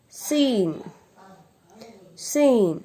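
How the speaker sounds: seen said high and clear as a long see-n; model pronunciation. Shown as a demonstration. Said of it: "Then seen".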